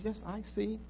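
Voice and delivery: very soft, inviting voice; tender reassurance. Acted. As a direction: answer softly, as a patient listener. Acting Jesus listening tenderly to believer.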